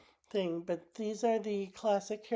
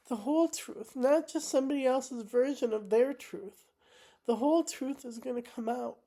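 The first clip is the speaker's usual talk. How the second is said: holding back tears, higher; emotional; 4 semitones higher than usual. Real, not acted. Telling viewers the truth will come out, emotional and higher. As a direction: voice pitched higher with real feeling. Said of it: "I apologize for getting emotional".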